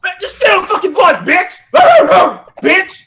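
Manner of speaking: high, loud yelling dog voice; shouting dog. Acted. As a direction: yell it like a worked-up dog. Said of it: "The dog in the biz ... going".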